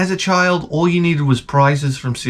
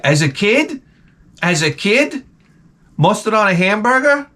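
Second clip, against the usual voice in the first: incredulous; 6 semitones higher than usual. Real, not acted. Repeated outraged question.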